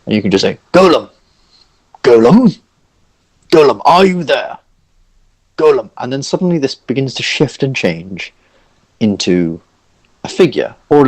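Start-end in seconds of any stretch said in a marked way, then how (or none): 0.7-5.9 higher, projected call. Acted. Figure in the projection calling his golem, raised and searching. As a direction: call out searchingly for someone missing.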